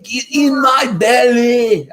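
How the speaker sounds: high hammed-up Scottish accent, shouted slowly; a bad Scottish growl, loud and drawn out. Dad trying a Scottish accent for the kids, bad and loud Scottish shout. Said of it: "I can't do a very good Scottish accent".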